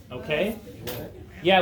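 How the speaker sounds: normal talking voice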